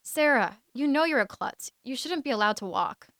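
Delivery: teasing jeer. Acted. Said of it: "He hid behind a well-worn jeer".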